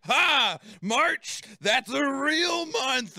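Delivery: high, loud, slowed triumphant cry; hammed-up gleeful outburst; mock triumphant. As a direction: crow over finally recognizing something. Recognizes a real month in dream.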